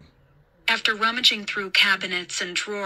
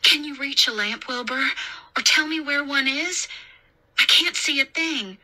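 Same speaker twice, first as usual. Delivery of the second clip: higher, lighter woman's voice; raised pitch, coaxing and practical; babysitter character voice; 6 semitones higher than usual. A narrator's reading. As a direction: ask a scared child for help.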